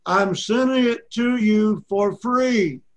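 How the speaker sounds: mock loud, drawn-out delivery; imitated loud repeat. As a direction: spell it out loudly and slowly, as if to someone who missed it. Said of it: "he said it very loud".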